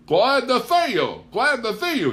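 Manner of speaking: high, loud, hearty welcome; beaming Paul. Acted. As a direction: boom a big farmer's greeting. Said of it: "he beamed".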